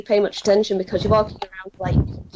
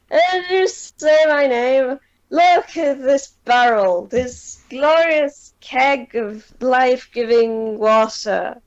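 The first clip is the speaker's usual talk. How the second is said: put-on higher, slow, merry drunk voice; 3 semitones higher, slower than usual. Drunk [name] shows off his water barrel, higher and merry. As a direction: slur it out like a happy drunk. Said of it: "[name] wanders out and goes".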